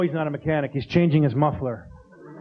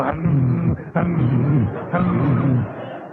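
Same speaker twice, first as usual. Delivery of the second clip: low stifled sneeze hums; sneeze noises; 5 semitones lower, much slower than usual. Retold or imitated. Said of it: "they go".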